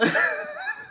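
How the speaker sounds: squeaky burst of laughter; high laugh. Real, not acted. Laughs at own reaction.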